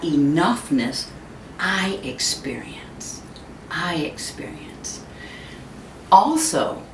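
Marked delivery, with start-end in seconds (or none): 1.6-3.2 slow whispered ending. Shown as a demonstration. A speaker ending a thought to the audience in a whisper. Softly repeated line end. 3.7-5.0 even softer, slow whispered echo. Shown as a demonstration. The speaker echoing the thought to the audience in a softer whisper. Softly repeated line end.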